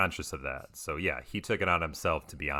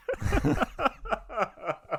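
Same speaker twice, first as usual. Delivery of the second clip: high laugh; 4 dB louder than usual. Real, not acted. Laughs at own landlord bit.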